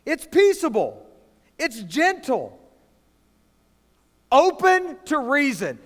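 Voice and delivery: fired-up preacher pressing the point; preaching at full force. Acted. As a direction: lift the congregation, preach it out.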